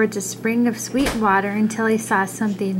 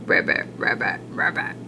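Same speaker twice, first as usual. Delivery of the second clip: soft, breathy, pouty frog croak; pouting little frog voice; 3 dB quieter than usual. Acted. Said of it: "the frog said with a pout".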